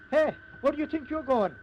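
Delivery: a deputy yelling at a man walking out; angry shout. Acted. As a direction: bark it to stop him leaving. Deputy stops a man leaving.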